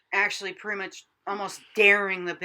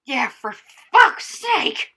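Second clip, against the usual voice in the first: loud; 4 semitones higher, 11 dB louder than usual. Real, not acted. Cohost joins the rant loudly.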